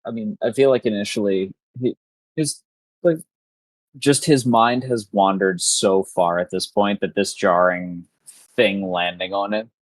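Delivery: ordinary talk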